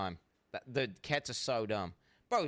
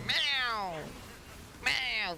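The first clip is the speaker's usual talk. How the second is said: imitating a cat meow; 11 semitones higher than usual. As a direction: copy the cat calling out. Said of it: "Now he goes".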